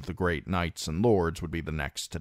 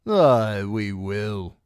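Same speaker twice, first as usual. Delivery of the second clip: cackling; 4 semitones higher, 6 dB louder, much slower than usual. A narrator's reading. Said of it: "came a cackle".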